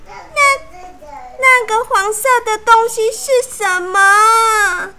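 high, loud crying shout; a little princess shrieking in dismay; sudden shout. A narrator's reading. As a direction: cry out in alarm, pitch up. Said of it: "爱哭公主突然大叫".

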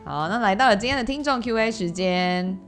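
ordinary delivery

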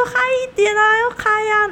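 high hype-man call; imitating a hyped relative; party hype. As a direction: pump up a glum table. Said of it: "他说".

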